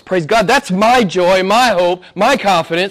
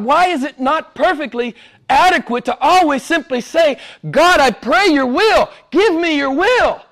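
much higher and pleading; fervent preaching; 13 semitones higher than usual. Acted. Preacher pleading over a sick wife, fervent. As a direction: cry out the prayer in earnest.

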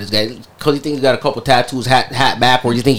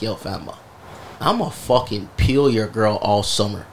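soft, slow, private gloat; quiet gloat to himself; 3 semitones lower, 6 dB quieter, slower than usual. Retold or imitated. Driving home gloating to himself, soft and slow. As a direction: savor it under your breath.

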